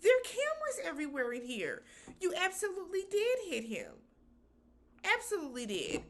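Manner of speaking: loud, fast and high, scolding; exasperated callout. Retold or imitated. Host scolding cast member about the cameras, loud and fast. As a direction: call her out loudly, fast. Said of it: "I wanted to say".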